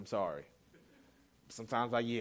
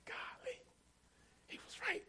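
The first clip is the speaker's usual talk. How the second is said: breathless whisper of surprise; whispered thought; 14 dB quieter than usual. Retold or imitated. Father realizing his son was right, whispered. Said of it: "That's what I'm thinking in my mind".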